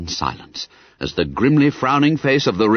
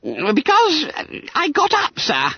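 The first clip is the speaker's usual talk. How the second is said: high, squeaky schoolboy voice; hesitant, squeaked excuse; Billy Bunter. A narrator's reading. Pupil explaining why he is not in bed, squeaking. Frightened pupil answering stern master.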